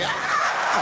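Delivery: long mock scream; drawn-out horrified howl; hammed-up agonised cry; freaking out. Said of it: "This guy was freaking out".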